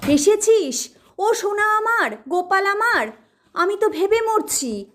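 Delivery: high, anxious, fussing mother; a mother fretting over her son, high and anxious. Acted. Mother greets her son at the door, fretting and high. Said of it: "মা হইচই করে উঠলেন".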